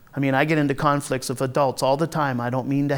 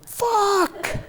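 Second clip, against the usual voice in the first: high laughing swear, much higher; laughing curse; 13 semitones higher, 5 dB quieter than usual. Real, not acted. Reacts to being asked to babysit.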